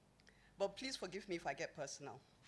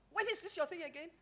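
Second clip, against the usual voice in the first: impatient friend; 11 semitones higher, 3 dB louder than usual. Retold or imitated. Aliko's impatient reply retold.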